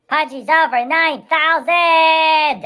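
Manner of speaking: slow, high, loud shouted hype; excited shout. Pretend. Joking about Pudgy's power, a slow, high shout. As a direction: bellow it like an anime power boast.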